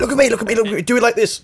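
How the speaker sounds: high, animated choir instructions; coaching the choir. Retold or imitated. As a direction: show the singers eagerly how to do it. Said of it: "There's a lot of me going, like".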